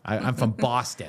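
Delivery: lightly put-on Boston accent; mock Boston accent. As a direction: play a proud Bostonian. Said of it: "That's the Boston accent".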